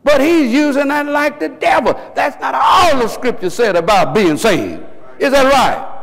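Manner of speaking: high, emphatic preacher's cry; full-force preaching. Real, not acted. Preacher warning the congregation about the devil's tricks, impassioned.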